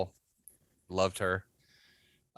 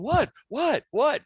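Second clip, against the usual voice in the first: mock innocent; 14 semitones higher, slower than usual. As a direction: play dumb with a high what. Ed playing innocent.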